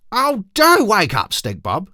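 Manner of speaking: put-on high, loud, slow little girl pleading. Little sister pleading with her sleepy brother. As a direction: beg him to get up. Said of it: "Ketchup Face pleaded".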